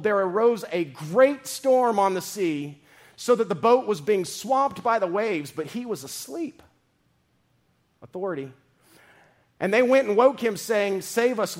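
lifted, dramatic Bible reading; scripture reading. Acted. Pastor reading Matthew to the congregation, dramatic reading. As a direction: read the passage with weight.

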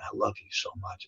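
barely audible, hushed tenderness; tender whisper. Retold or imitated. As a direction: whisper into his ear while rocking him. Rocking the crying player.

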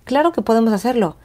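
high airy "Ay, ya qué fácil"; carefree mock shrug; breezy dismissal. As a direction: brush it off as if it were nothing. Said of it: "Entonces digo".

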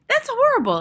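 high, loud outburst of disgust; appalled, pitched right up; horrified protest. A narrator's reading. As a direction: cry out in outraged protest. Narrator protests at the cruelty.

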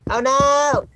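loud high panic; panicked yelp. Acted. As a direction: yelp as you flee. Being chased.

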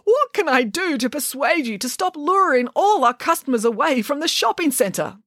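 high, desperate plea; desperate manager pleading; begging. A narrator's reading. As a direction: implore them, pitch held up throughout. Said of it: "begged the manager".

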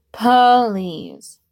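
stretched scornful please; a lazy scoffing please; drawl. A narrator's reading. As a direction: drag it out, unimpressed. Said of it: "she drawled".

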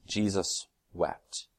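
slow and soft, full weight on Jesus; hushed, slow. Shown as a demonstration. Preacher reading the shortest verse to the church, hushed and slow. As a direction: let the verse hang quietly. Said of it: "shortest verse read slowly".

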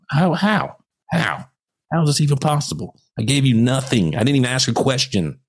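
retold disbelief. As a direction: replay being baffled by an impossible guess. Retells stunned reaction to reading.

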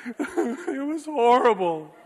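very high, drawn-out mock wail; imitated crying. Tearful daughter answering mom, exaggerated crying. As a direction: blubber it slowly like a sobbing child, hammed up. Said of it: "And she's crying".

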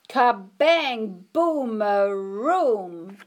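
loud bang then slow rolling boom; thunder crash. A narrator's reading. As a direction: crash it, then let it roll. Thunder sound effect in story.

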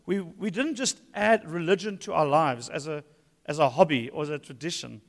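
ordinary talk, nothing marked

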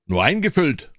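called out. A narrator's reading. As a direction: call it out to the devil, mildly. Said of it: "rief der Soldat". (munter ausgerufen, leicht erhobene Stimme)

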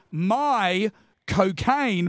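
"My cocaine" stretched very slowly in a light English accent; slight English accent. Shown as a demonstration. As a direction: drawl "My cocaine" like Michael Caine. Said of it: "you say it with a slight English accent".

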